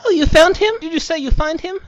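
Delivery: sudden high, loud burst of hope; hopeful shock. Pretend. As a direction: jump at the news, loud and high. Frantic mum hears hopeful news.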